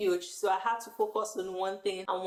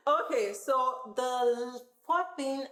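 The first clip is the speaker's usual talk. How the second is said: laughing; 4 semitones higher, much slower than usual. Real, not acted. Still laughing after giggle.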